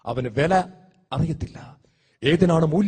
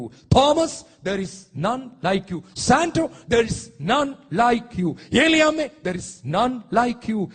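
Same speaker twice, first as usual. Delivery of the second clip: very slow, word-by-word declaration; God addressing each person; much slower than usual. Acted. Preacher voicing God to named listeners, slow emphatic declaration. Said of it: "ദൈവം പറയും".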